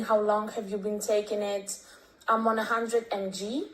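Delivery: ordinary talk, nothing marked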